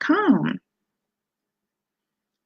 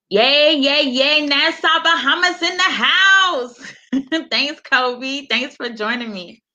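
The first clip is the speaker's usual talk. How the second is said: high, bright yay with laughing thanks; excited cheer; 8 semitones higher, slower than usual. Real, not acted. Host cheering Bahamas viewers on livestream, excited. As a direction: celebrate the viewers from Nassau.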